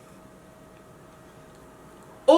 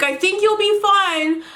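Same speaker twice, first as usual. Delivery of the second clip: higher, drawn-out mock reassurance; mimicking the orthodontist; 7 semitones higher than usual. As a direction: lightly imitate a noncommittal orthodontist, pitched up and slow.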